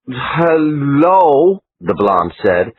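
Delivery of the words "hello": higher, huffy Austrian-American hello; offended Austrian-American greeting; Austrian-American accent. Acted. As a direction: supermodel snapping back at the reporter. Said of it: "Austrian-American accent".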